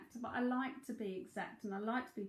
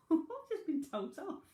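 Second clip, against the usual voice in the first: laughing; 6 semitones higher than usual. Real, not acted. Laughing at being scolded.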